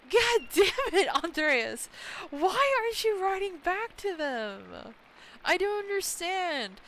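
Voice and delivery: high, loud, slow frustrated shout; exasperated yell. Real, not acted. Gamer yelling at Andreas for ignoring letters, loud and exasperated. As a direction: yell at him for not writing back. Scolding game character.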